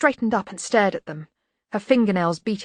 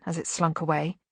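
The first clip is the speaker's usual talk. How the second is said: ordinary delivery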